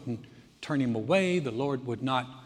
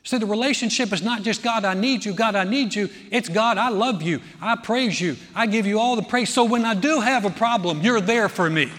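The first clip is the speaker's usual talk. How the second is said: raised, louder sermon voice; impassioned preaching; 5 semitones higher, 6 dB louder than usual. Acted. Preacher voicing love and praise to God, loud and impassioned. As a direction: press each declaration home with force.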